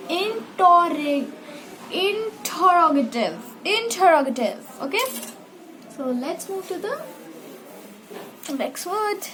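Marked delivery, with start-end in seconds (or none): 0.1-4.9 interrogative said slowly with the stressed part stretched long; stressed and lengthened. Shown as a demonstration. Teacher showing long stress on interrogative. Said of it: "pressure देना है, उसे लंबा बोलना है".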